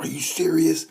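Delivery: high, loud "are you serious"; can't believe what he just saw; stunned disbelief. Retold or imitated. Fan watching the finish, stunned and high.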